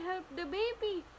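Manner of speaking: high and slow, hammed-up; mock alarm. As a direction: pretend the doll is in danger. Repeated later plainly. (kunwaring nag-aalala)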